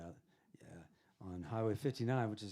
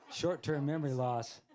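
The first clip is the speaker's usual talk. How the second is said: slow, laughing punchline, louder and higher; laughing joke; 6 semitones higher, 9 dB louder, slower than usual. Real, not acted. Joking to the host about forgetting, laughing. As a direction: chuckle through the joke.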